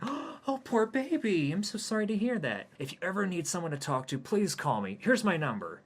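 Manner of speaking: very high, cooing woman; sympathetic woman. Acted. Coffee shop woman coos at Hayden, very high. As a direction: gush with pity.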